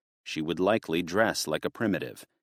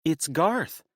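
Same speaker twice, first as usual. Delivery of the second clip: higher, lighter wife voice; Connie's voice; 10 semitones higher than usual. A narrator's reading. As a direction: voice the wife hopeful. Connie's voice, high.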